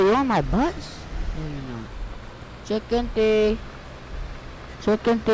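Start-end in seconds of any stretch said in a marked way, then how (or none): none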